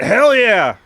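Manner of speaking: high, loud battle whoop; defiant scream. Acted. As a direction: scream it back at the storm. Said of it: "She screams at it".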